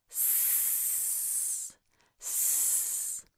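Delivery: long sustained hissed "S", no vowel; S sound. Shown as a demonstration. Teacher shows the sound S makes, long hissed sss. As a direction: hold "S" as a drawn-out sss. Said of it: "S makes the sound".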